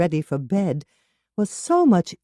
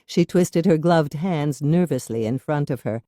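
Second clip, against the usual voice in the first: ordinary delivery, nothing marked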